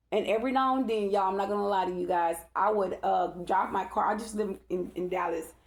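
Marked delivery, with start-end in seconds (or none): none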